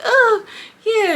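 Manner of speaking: high, loud mock "oh yeah"; mocking nonchalant. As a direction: toss it off high and smug. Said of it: "the girl just sitting there like".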